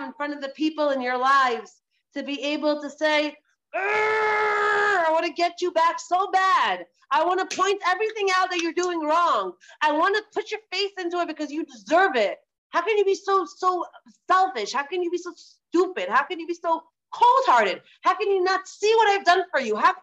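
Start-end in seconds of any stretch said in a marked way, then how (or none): 3.7-12.4 acted-out pent-up urge to retaliate; frustrated resentment. Acted resentful urge to get back. 12.7-19.9 higher-pitched, exasperated accusations; acted-out hurt outrage, piling up the reproaches; indignant reproach. Acted reproach of a loved one.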